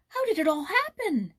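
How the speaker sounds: high, loud, delighted question; beaming cry. A narrator's reading. Joyce asking Jules about the news, delighted cry. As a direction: ask with a beaming face. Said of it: "she cried with a beaming face".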